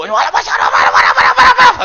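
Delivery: loud, high panicky babble; loud frightened praying. Retold or imitated. Pastor imitating a frightened man praying loudly. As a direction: blurt it out like a scared man praying. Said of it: "saking kencengnya".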